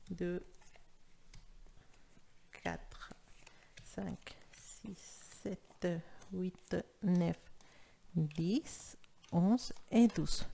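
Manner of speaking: counting cards in a whisper. Real, not acted. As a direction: count under your breath while laying cards. Counting cards under her breath.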